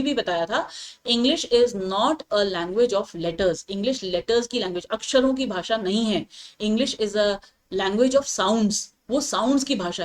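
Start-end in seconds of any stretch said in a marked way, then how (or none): 1.1-3.6 slow, word by word, like dictating to a class; slow dictation. Shown as a demonstration. Teacher reads the English rule slowly to her class, word by word. 6.6-8.8 slow dictation. Shown as a demonstration. Teacher reads rule slowly.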